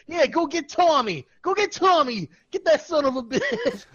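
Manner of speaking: put-on Boston accent [name] cheering on; Boston fan [name]. [name] answers the Boston clown, in a loud Boston accent.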